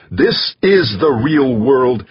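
nearly shouting, leaning on every word. A narrator's reading. Jamie defends Mars against Dex, in a slow near shout. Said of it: "Jamie fairly shouted".

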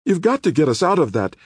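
high, frightened insistence; shuddering demand. A narrator's reading. Rachel begging the attorney for rescue, shuddering. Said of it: "Rachel demanded, shuddering".

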